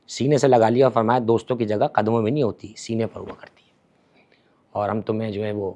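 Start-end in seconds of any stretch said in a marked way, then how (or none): 1.3-5.7 tender whisper. Acted. Imam embraces Hurr tenderly.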